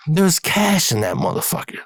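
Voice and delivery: ordinary talk, nothing marked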